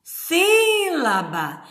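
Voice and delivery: "Sílaba" high and drawn out on SÍ; long, high SÍ, then la-ba; stress on SÍ. Shown as a demonstration. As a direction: call out "Sílaba" slowly, leaning on SÍ. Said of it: "qual que é a tonicidade de sílaba".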